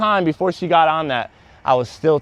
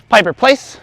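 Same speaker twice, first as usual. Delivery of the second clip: raised firm call of the dog's name and place; firm command; 8 semitones higher, 6 dB louder than usual. Real, not acted. Trainer calling dog to place, firm and raised. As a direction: call the dog to her spot.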